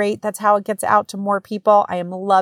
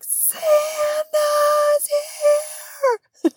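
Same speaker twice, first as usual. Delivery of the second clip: put-on squealing kids, much higher; excited kids; much slower than usual. Kids spotting Santa at the mall, squealing.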